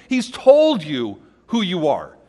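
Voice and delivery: ordinary delivery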